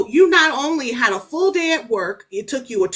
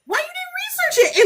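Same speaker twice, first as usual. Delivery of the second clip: much higher, exasperated question. Retold or imitated. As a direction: throw up your hands at her. Exasperated at the author.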